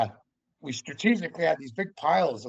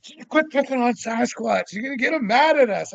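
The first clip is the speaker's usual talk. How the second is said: much higher, imitating a nagging wife; mock-scold him in a high voice; 10 semitones higher, 5 dB louder than usual. Wife telling husband to leave Sasquatch alone, high scolding.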